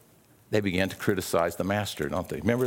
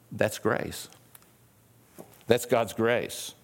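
ordinary talk, nothing marked

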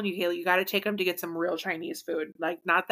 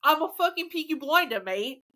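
high, hammed-up British accent; exaggerated put-on British voice; mock British accent; 8 semitones higher than usual. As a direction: play a caricature Brit. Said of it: "You can talk British".

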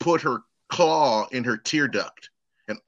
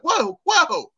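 much higher, stunned double take; shocked surprise; 10 semitones higher, slower than usual. Retold or imitated. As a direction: hit it like the news just landed. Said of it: "I'm like".

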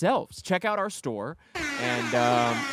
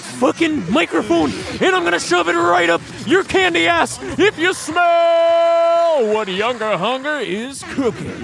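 much higher, louder, slowing wrestler roar; wrestling promo; 11 semitones higher, 7 dB louder than usual. Pretend. Hyping the podcast comeback, hammed-up wrestler promo. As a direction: growl it like a pro wrestler cutting a promo. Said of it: "wrestler-style hype promo".

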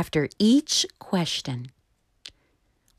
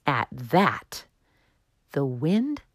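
usual speaking voice

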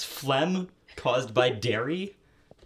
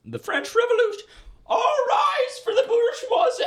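herald voice; 8 dB louder than usual. Pretend. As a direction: announce it like a royal herald. Mock herald proclamation.

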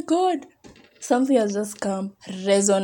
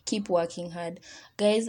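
ordinary talk, nothing marked

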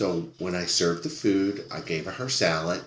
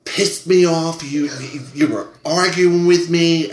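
loud, higher angry yell at the waitress; retold screaming; 9 semitones higher, 8 dB louder, slower than usual. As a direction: shout it like the furious customer. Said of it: "starts screaming at me".

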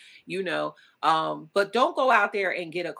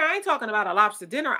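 ordinary talk

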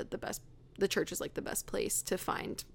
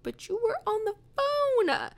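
incredulous; 14 semitones higher, 3 dB louder than usual. Retold or imitated. Frustrated retort to lead photographer.

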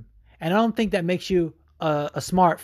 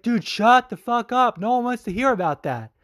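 exasperated retort; 7 semitones higher than usual. Retold or imitated. Retold retort to the fighter's speech, exasperated. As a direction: tell him to shut up, exasperated. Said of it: "I was like".

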